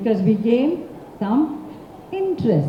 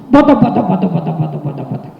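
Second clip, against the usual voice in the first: elephant footsteps; 8 semitones lower, 4 dB louder, much faster than usual. Acted. Fleeing elephant's stomps fading away. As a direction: thunder off into the distance.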